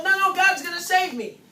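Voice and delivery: a flood victim waving off the boat; mock stubborn no, no; stubborn refusal. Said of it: "and the boat comes in and say".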